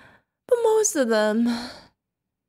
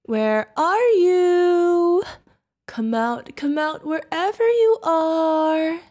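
drawn-out taunting call; calling out, hunting; 5 semitones higher, slower than usual. Acted. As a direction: call through the house like a predator.

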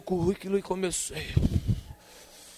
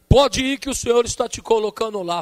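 prophetic command; 6 semitones higher, 8 dB louder than usual. Retold or imitated. Missionary sends him off.

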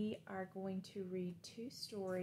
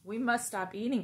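cried; 2 semitones higher, 7 dB louder than usual. Acted. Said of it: "cried Toad".

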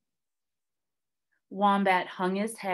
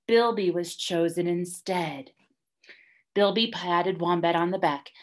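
usual speaking voice

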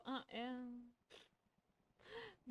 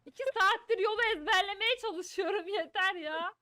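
loud, raised-pitch complaint; fed-up shouting, at the end of patience; exasperated outburst; 5 semitones higher, 6 dB louder than usual. Real, not acted. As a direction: yell at friends who keep blocking you. Exasperated at others blocking her.

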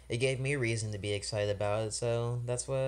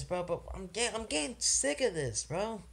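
higher pitched and exasperated; sick of it, let it show; fed-up complaint; 7 semitones higher than usual. Real, not acted. Vlogger fed up with [name]'s filler albums, exasperated. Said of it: "getting upset like this".

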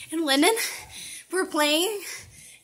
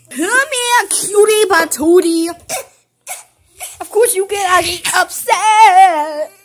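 high, loud, slow sing-song coo; 3 semitones higher, 7 dB louder, slower than usual. Real, not acted. Big sister cooing to baby Billy about the food, slow baby talk.

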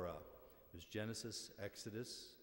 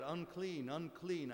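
higher-pitched retold call of unclean; mock leper's cry; 8 semitones higher, slower than usual. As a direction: cry out a warning without full force. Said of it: "And they had to shout".

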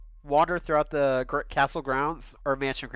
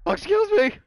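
much higher, louder startled cry; startled yelp; 6 dB louder than usual. Real, not acted. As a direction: jump at the scare. Startled by a game jump.

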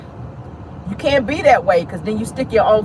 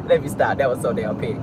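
ordinary delivery

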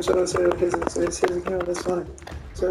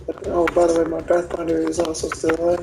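normal talking voice

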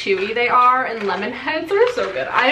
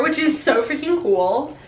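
normal talking voice

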